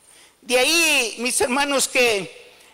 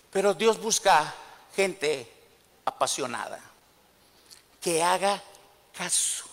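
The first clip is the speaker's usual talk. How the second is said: hushed emphasis; 6 semitones lower, 6 dB quieter than usual. Real, not acted. Sermon point delivered hushed.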